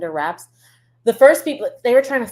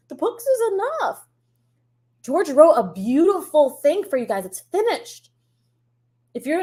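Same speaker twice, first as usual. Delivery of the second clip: ranting high and shrill at the showrunners, pitch well up throughout; 7 semitones higher than usual. Acted. Fan ranting at the showrunners, high mock fury. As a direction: scold the show makers like a furious fan. Chat rant at show makers.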